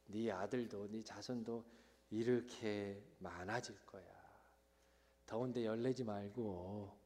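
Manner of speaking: low, soft, patient voice; gently calm an upset child; tender reassurance, hushed and unhurried; motherly soothing. Acted. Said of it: "마치 엄마처럼".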